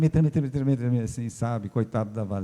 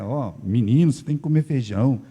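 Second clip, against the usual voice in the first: put-on scolding the boy; 4 dB louder than usual. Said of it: "pro senhor falar pra ele".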